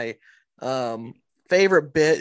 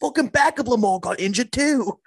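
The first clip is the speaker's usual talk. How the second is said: put-on Baltimore accent slipping into British, higher; botched Baltimore accent; 4 semitones higher than usual. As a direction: attempt Baltimore and land on British. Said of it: "I'm doing a British accent".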